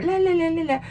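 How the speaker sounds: high, singsong mock chatter; mocking babble. Mocking media chatter as nonsense.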